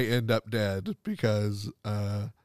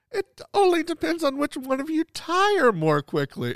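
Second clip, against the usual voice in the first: high mock voice. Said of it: "it's like".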